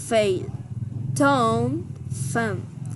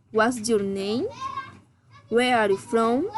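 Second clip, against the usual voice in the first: ordinary talk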